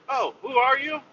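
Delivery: high-pitched imitation of forgetful staff; mock clueless greeting. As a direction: act as if meeting a stranger. Staff fail to recognise the regular.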